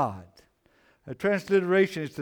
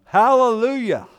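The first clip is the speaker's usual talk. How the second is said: joyful exclamation; 7 semitones higher, 8 dB louder than usual. Real, not acted. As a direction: ring out hallelujah loud and high. Preacher exclaims after Psalms.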